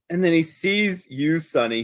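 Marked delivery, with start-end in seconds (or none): none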